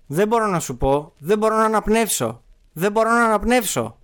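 put-on high-pitched child's desperate plea; acted distress. Child in script cannot breathe. (απεγνωσμένα, σαν παιδί)

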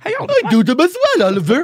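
hammed-up desperate bid to impress; eager showing off. Podcaster begging Oliver Callan to notice, hammed-up eagerness. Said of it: "am I subconsciously showing Oliver Callan".

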